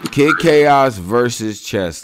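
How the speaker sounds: drawn-out hype announcement; announcer call. Real, not acted. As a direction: call the matchup like a ring announcer. Host announcing the matchup.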